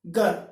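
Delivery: घर said as गर, plain unaspirated g; aspiration missing. Shown as a demonstration. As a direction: drop the breath from घर so it starts like ग. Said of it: "ग तक ही पहुंचते".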